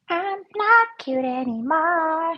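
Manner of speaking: high, slow mock voice; put-on character voice. Painter jokes about outgrowing cute doodles, put-on voice. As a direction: play it up high and drawn out.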